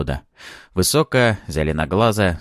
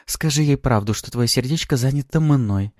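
higher, sweetly teasing female voice; lightly voiced flirty demoness; playful succubus; 6 semitones higher than usual. A narrator's reading. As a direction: coax him with mock-sweet possessiveness. Succubus teasingly claims hero's heart.

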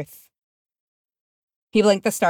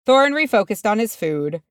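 ordinary delivery

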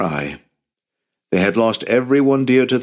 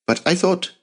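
put-on boy voice; 11 semitones higher than usual. A narrator's reading. Jacob voiced higher.

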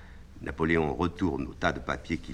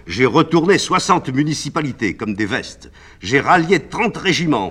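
higher, louder rousing declamation; grandiose emperor; 10 semitones higher, 13 dB louder than usual. Acted. Napoléon boasts to the lieutenant, loud and rousing. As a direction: orate like a triumphant emperor.

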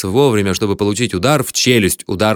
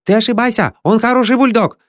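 high-pitched foreign seller insisting; piping protest; 11 semitones higher than usual. A narrator's reading. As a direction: argue back in a very high voice. Foreign seller argues back. (спорить тонким, высоким голосом)

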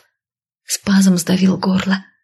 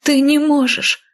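higher, slow anguished protest; higher, horrified outcry; horrified cry; 8 semitones higher than usual. A narrator's reading. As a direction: beg against the unthinkable. She cries out in horror.

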